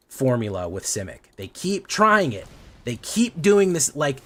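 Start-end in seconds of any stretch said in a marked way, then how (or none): 1.4-4.2 real rising anger, words dragged out; getting mad. Real, not acted. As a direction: let the frustration boil over. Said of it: "You can hear how hot I'm getting".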